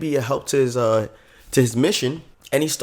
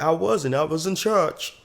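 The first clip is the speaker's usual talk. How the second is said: higher, drawn-out Southern protest; mock Southern accent; 4 semitones higher than usual. Kid defends himself to doubters, mock Southern accent. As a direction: deny it in a playful Southern accent. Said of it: "doing, like, a whole Southern accent".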